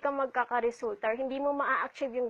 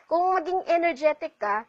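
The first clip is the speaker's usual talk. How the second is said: usual speaking voice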